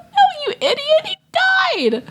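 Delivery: very high, laughing mock cry; half-laughing, high-pitched scolding of a fool; mock exasperated wail. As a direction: wail it in hammed-up disbelief. Mock rebuke to naive child self.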